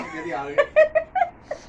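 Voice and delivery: high, squealing laugh; a real laugh bursting out; burst of laughter. Real, not acted. Laughs after failed challenge. (ज़ोर की हँसी)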